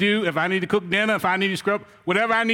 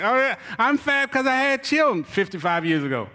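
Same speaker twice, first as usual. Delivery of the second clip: higher, slowed, laughing mock of an excuse-maker; teasing impression of an arguing partner; 4 semitones higher than usual. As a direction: mimic a spouse making excuses. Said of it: "I hear these people arguing".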